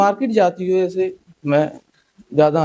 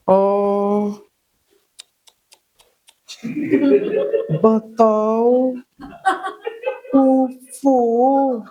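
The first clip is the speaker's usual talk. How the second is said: high, drawn-out exaggerated lament; mock a woman wailing over faded clothes; hammed-up auntie fuss; gossiping woman; 5 semitones higher, much slower than usual. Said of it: "वो filler ऐसे दे रही हैं".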